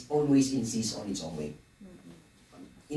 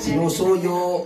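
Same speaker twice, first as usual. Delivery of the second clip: Sinusuyo called out higher and louder, laughing; playful loud repeat; 6 semitones higher, 6 dB louder, much slower than usual. Shown as a demonstration. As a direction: throw out Sinusuyo with gusto. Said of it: "malalim yung inaalo pala".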